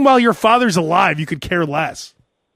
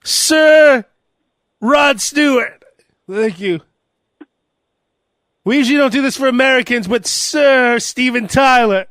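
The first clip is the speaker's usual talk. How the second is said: ceremonial announcer; 4 semitones higher, slower than usual. Pretend. Mock knighting ceremony.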